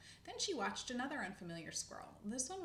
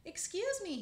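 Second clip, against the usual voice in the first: high, loud call for attention; 11 semitones higher, 8 dB louder than usual. A narrator's reading. Old squirrel to an unfamiliar squirrel, calling out. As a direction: call out brightly to a passer-by. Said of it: "he called out".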